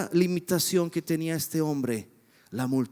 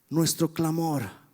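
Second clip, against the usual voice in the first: ordinary talk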